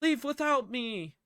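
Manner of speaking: high mock desperate yell, easing off; urgent cry. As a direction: cry out like a dramatic movie hero. Acting urgent cry in retold scene.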